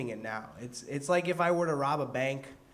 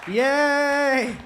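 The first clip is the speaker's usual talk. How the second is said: high drawn-out yay; high cheer; 12 semitones higher, 12 dB louder than usual. Acted. Cheering to get applause.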